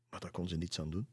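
plain reading voice

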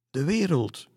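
high, loud exclamation; calling out. A narrator's reading. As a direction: cry it out over the others. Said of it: "riep een andere dame uit".

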